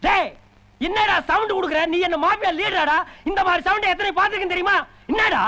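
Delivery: high-pitched scolding shout; angry retort. Acted. Guest standing up to the host's bluster, angry retort. Acted quarrel, hits back.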